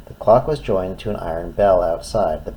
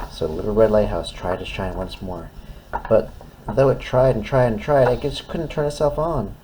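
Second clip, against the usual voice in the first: ordinary delivery, nothing marked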